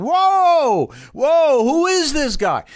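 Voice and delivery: high, drawn-out whoas; astonished reaction. Acted. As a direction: exclaim in stunned amazement. Acting crowd's amazed reaction to new leader.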